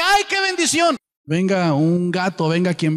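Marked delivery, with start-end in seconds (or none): 0.0-1.0 much higher, swooning mock delight; gushing churchgoer. Preacher mocking a churchgoer thrilled by any sermon, high gushing. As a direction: gush like someone carried away by any sermon.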